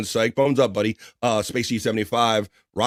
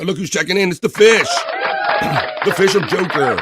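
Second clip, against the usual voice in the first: laughing welcome; 9 semitones higher, 6 dB louder than usual. Real, not acted. Greeting Joker Fish, laughing.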